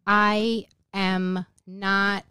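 very slow, word by word; retold vow, heavily spaced; firm refusal. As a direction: draw out each word with resolve.

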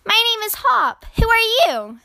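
put-on high, excited child voice; high-pitched friendly cub; little bear. A narrator's reading. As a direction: introduce yourself like a keen bear cub. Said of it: "said the little bear".

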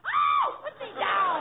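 high shriek of surprise; startled yelp. Acted. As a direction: yelp as you're grabbed. Being lifted, yelps.

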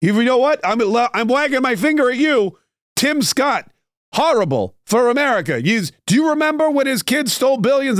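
mock finger-wagging rant, pitched high; Chris Christie scolding. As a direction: scold a rival in a hammed-up high voice. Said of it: "He's not gonna say".

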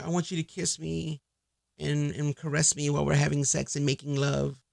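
soft, hushed mock-romantic voice; tender request. Woman asking her man to caress her, mock tender. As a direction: plead gently and lovingly, softly, as a parody.

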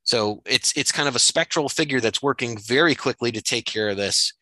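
ordinary talk

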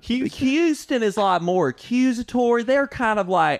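put-on Houston accent. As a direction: mimic a Houston accent, drawing words out. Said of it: "They kinda draw things out".

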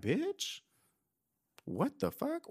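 shriek-rise of shock at your younger self; incredulous. Real, not acted. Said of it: "I'm looking at that like".

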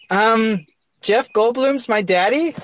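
puzzled woman. Acted. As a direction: imitate a bewildered woman, slow and high. Voicing the woman in bed.

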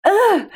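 high fed-up groan; exasperated ugh. Retold or imitated. The inner voice groaning at her, exasperated. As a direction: groan like a voice tired of asking. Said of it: "the voice was almost like".

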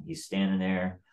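ordinary talk, nothing marked

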